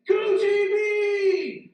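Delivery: mimicking the roaring crowd; crowd shout. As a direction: bellow it like fans at the finish. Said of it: "They're shouting".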